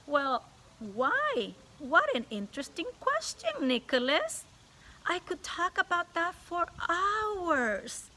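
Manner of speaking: sweet on top, steel underneath; too-sweet teacher. Acted. Stern teacher answering the boy's trick question, falsely sweet. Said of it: "a smile that was just a little too sweet to be real".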